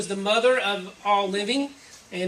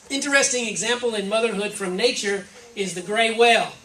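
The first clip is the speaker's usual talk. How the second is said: ordinary talk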